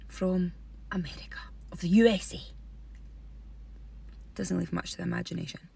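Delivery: hushed, low and half-whispered; mock discreet. Comedian avoids naming the shop directly, mock-discreet hush.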